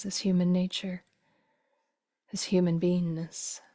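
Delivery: usual speaking voice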